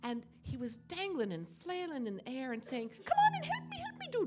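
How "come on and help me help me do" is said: very high, loud squeaky cry; tiny squeaky voice. Acted. Leprechaun calling from thorn.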